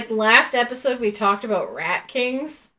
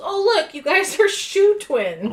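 imitating Mickey; 10 semitones higher than usual. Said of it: "And then he goes".

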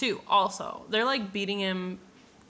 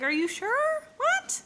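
high, squeaky mock disbelief; incredulous; slower than usual. Santiago questioning the alchemist's farewell, mock incredulous. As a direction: voice climbing ever higher into a squeak.

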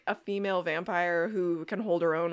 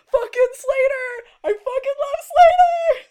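high, loud, drawn-out squeal of delight; excited fan shriek; 12 dB louder, slower than usual. Real, not acted. Host cheering as favourite character Slater comes up, ecstatic shout. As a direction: shriek it with gleeful adoration.

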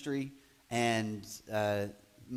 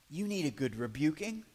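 hushed rebuke; 9 dB quieter than usual. Retold or imitated. Peter taking Jesus aside, scolding him in a hushed voice. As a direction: pull him aside and hiss it quietly. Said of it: "You imagine going".

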